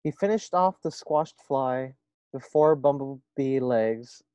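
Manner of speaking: lively storybook reading voice; reading to children. A narrator's reading. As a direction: read it aloud to a class. Reads story aloud to first graders.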